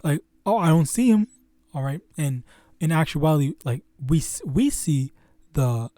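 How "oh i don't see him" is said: higher, mocking dismissal; mocking scoffer. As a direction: mimic a sneering doubter. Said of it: "this is a scoffing".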